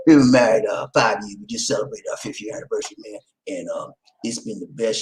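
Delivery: usual speaking voice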